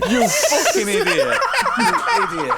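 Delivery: high, loud, laughing insult; laughing scolding. Real, not acted. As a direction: crack up while calling a friend out. Scolds friend's wasted question amid laughter.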